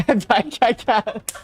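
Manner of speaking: cracking up, high-pitched; real laughter, unable to get through it; laughing. Real, not acted. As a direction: give up in a fit of laughter. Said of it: "Now I'm just laughing".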